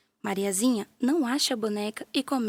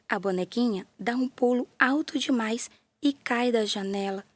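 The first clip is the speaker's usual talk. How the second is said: plain reading voice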